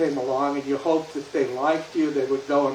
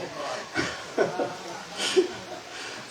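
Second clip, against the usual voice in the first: quiet unvoiced chuckle; a soft laugh at the tiny number; 6 dB quieter than usual. Real, not acted. Chuckles after two cars per hour.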